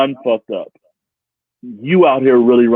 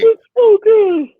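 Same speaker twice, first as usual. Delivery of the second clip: delighted; 6 dB louder, slower than usual. Real, not acted. Delighted exclamation.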